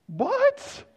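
high, astonished what; amazed what. Retold or imitated. Preacher imitating amazement at the changing canyon colors. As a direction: shoot the what up high in disbelief.

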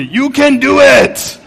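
high, slow, hammed-up cheering voice; Water Boy coach cheer. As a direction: cheer him on like the movie coach. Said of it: "this guy, periodically throughout the movie".

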